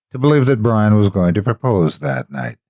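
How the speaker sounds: ordinary delivery, nothing marked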